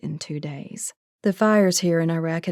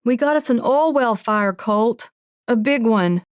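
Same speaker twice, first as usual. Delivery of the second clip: raised and higher, pushing over the roar; near shout into the phone; 5 semitones higher, 5 dB louder than usual. A narrator's reading. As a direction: call it loud down a bad phone line. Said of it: "nearly shouting into the phone".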